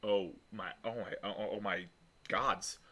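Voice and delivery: soft, halting disbelief; stunned. Acted. Alina hears the surname Burke, stunned and hushed. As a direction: trail haltingly through the shock, hushed. NPC stunned by the family name.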